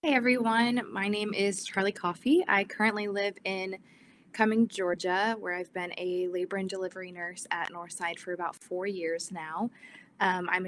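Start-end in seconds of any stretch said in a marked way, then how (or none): none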